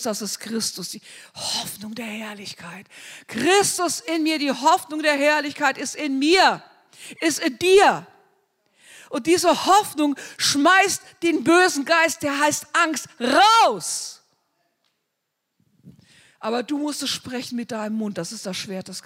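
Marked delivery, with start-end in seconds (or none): 3.3-14.2 loud, high, rising preacher's cry; fervent sermon climax, casting out the spirit; preaching at full force. Acted. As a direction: lift the hope of glory to a shout.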